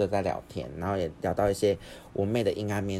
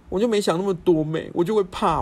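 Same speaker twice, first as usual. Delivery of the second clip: much higher and louder, put-on frightened kid-brother voice; mock scared brother; 11 semitones higher, 7 dB louder than usual. Host imitating his brother saying he was scared, in a much higher mocking voice. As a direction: mimic the brother's panicky excuse. Voicing brother's fearful excuse.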